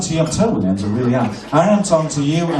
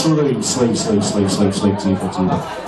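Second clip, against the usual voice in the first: hypnotic sleep command; 5 semitones lower, slower than usual. Acted. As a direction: lull them down into sleep.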